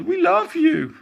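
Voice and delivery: loud, high adoring cheer; shouting. A narrator's reading. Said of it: "they shouted".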